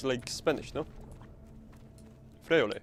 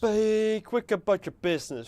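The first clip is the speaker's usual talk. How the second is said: mimicking game voice; 7 semitones higher than usual. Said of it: "repeats the game voice line".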